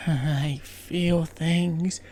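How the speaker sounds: very slow, breathy-free soulful drawl; "I feel things" stretched very slowly and dreamily; mock soulful. Couple staring into each other's souls, very slow dreamy. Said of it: "staring in each other's souls and being like".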